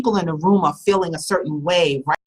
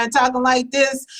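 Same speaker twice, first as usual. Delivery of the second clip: pitched up, held high; going up high; 7 semitones higher than usual. Shown as a demonstration. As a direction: lift the voice up in the air. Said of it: "if you start going up in the air".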